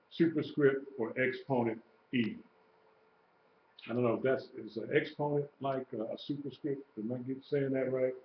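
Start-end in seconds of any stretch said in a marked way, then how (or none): 3.9-8.1 half to yourself, quietly unsure; unsure aside. Real, not acted. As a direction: drop the volume, checking if that's right. Unsure aside to check a term.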